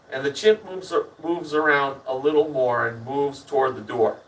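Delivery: ordinary delivery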